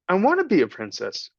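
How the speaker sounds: put-on a scrappy kid asking hopefully; rough little girl. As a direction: Meg asking the rat about her future. Meg's voice.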